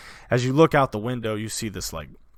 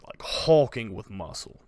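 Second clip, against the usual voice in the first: usual speaking voice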